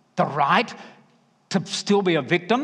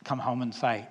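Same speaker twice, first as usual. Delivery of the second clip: usual speaking voice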